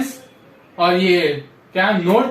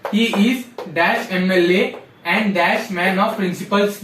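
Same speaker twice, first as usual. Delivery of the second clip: slow dictation; much slower than usual. Shown as a demonstration. Teacher dictating an exam sentence.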